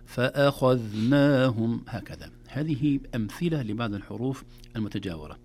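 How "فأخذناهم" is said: فأخذناهم slow and loud, moderately light hamza; thin the hamza of فأخذناهم without overdoing it; ترقيق بلا مبالغة. Shown as a demonstration. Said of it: "لا نبالغ في الترقيق أكثر من لازم". (فأخذناهم بترقيق معتدل وصوت عالٍ)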